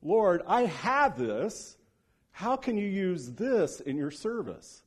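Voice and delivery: earnest prayer. Shown as a demonstration. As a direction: lift "Lord, I have this" up and draw it out slowly. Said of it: "we never pause and think".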